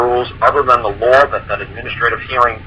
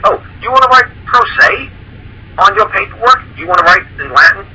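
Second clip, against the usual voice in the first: much higher, sing-song mock of a know-it-all; 10 semitones higher than usual. Mocking the pro se writers to his co-host, much higher sneer. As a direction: lampoon the wannabe legal expert, pitched up high.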